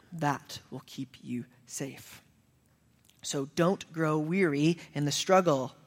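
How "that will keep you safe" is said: slow, breathy hushed whisper. Real, not acted. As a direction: lean in and whisper the promise. Sermon hushes for intimate point.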